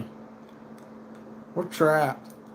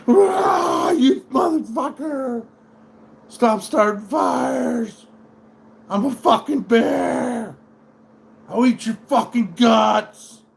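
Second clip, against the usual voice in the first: furious, much higher, slow roaring shouts; enraged bear; 9 semitones higher, 6 dB louder, slower than usual. Retold or imitated. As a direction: scream it like a wild bear attacking. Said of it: "like the Revenant ... just be like".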